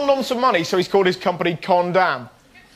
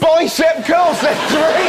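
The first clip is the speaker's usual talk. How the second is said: loud, high, slow outrage; outraged shout; 6 semitones higher, 4 dB louder, slower than usual. Real, not acted. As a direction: shout in disbelief. Outraged at toddler exercises.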